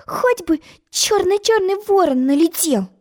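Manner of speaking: high, wistful wish; high forlorn sigh of a wish; hopeless. A narrator's reading. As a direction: wish it in despair. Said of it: "безнадёжно подумала Алиса".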